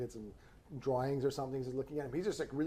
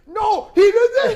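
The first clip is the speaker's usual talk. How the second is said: mock accusing shout; 17 dB louder, slower than usual. Pointing blame at the child.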